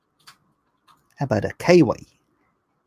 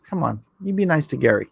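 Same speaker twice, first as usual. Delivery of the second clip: ordinary delivery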